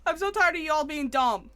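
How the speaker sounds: loud fed-up complaint; fed-up shout. Real, not acted. Player yells at the game's guards, fed up. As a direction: let your frustration out loud. Ranting at the game.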